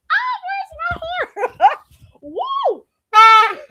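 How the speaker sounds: high, slow, squealing call for a horn; laughing hype. Real, not acted. Celebrating her own joke to the chat, squealing laugh. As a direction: hype herself up mid-laugh.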